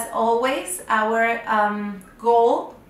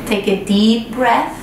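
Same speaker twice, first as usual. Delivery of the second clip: unhurried breathing cues, louder than the chatty intro; guided meditation; 3 dB louder than usual. Acted. Guide to children lying in bed, guided meditation voice. Leads breathing before bedtime story.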